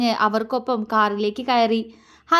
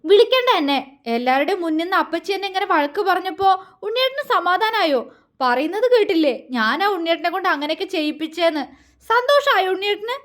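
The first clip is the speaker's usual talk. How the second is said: high-pitched, hurt outburst; tearful reproach; 7 semitones higher, faster than usual. A narrator's reading. Malu blaming Vinod for her scolding, tearful and hurt. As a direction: reproach as if close to sobbing, pitch raised. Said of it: "അവൾ തേങ്ങിക്കൊണ്ട് ചോദിച്ചു".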